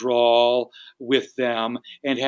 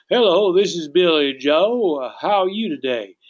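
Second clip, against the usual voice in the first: Southern accent; 3 semitones higher, 4 dB louder than usual. Shown as a demonstration. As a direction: stretch the greeting like a friendly Southerner. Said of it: "to do a good Southern accent".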